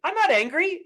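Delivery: high, loud denial; angry denial. Retold or imitated. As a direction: snap back in denial. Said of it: "you look angry".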